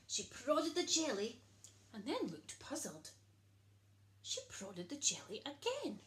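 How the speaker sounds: plain reading voice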